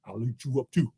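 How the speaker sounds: low, soft menace; bear's quiet threat. Acted. Bear's final threat, dropped low.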